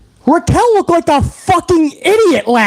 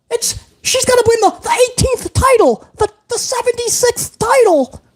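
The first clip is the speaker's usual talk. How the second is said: much higher, squealing fan gloating; mocking gleeful fan; 10 semitones higher than usual. Mocking fans taunting B C about the queen's return. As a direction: ham up a giddy fan crowing over B C. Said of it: "with a hundred and forty characters going".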